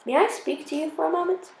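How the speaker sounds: a hint of a polite German cadet; German accent. German cadet asks to talk.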